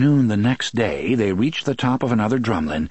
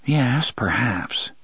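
plain reading voice

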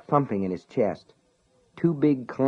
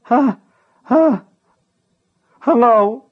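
very high, loud frightened shriek; panicked squeaky yelps; shrieky little voice; 14 semitones higher, 9 dB louder, slower than usual. Acted. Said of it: "in the same shrieky little voice".

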